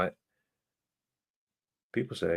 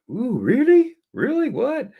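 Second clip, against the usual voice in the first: very high, squealing "really?" rising; amazed disbelief; 4 dB louder, slower than usual. Retold or imitated. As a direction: gasp it out in delighted disbelief. Amazed at movie trails news.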